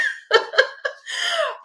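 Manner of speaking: bright high falling laugh. Real, not acted. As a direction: giggle up high and let it fall. Laughs after describing herself.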